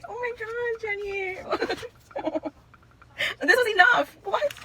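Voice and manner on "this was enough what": high, loud choked-up cry; tearful excitement. Real, not acted. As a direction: cry it out, too touched. Overwhelmed, near tears.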